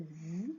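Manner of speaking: Z drawn out as a voiced buzz; buzzing Z sound. Shown as a demonstration. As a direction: hold the Z slowly, zzz. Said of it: "chante le Z".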